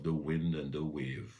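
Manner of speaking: usual speaking voice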